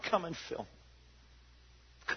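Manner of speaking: breathy slow prayer whisper. Acted. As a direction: pray it under your breath. Voiced prayer inside sermon.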